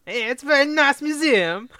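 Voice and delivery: Borat impression. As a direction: ham up a Kazakh reporter voice, very high. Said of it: "He's not, not Borat".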